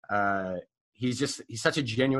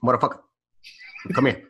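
gruff coach call; 4 dB louder than usual. Retold or imitated. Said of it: "He goes".

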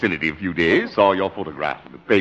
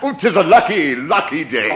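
chuckling, lifted gloat over a sucker; laughing, sing-song triumph; gleeful gloating; 8 semitones higher, 4 dB louder than usual. Acted. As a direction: crow with delight at the lucky break. Con man gloats over easy mark.

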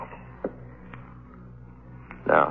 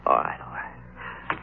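resigned whisper; 10 semitones lower, 9 dB quieter, much slower than usual. Acted. Patient gives in, deflated.

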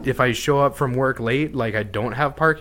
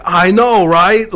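high, loud New Yorker chiming in; 8 semitones higher, 10 dB louder, slower than usual. Retold or imitated. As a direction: blurt it out like an eager New Yorker. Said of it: "and be like".

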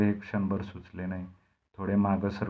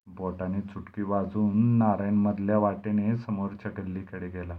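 ordinary delivery, nothing marked

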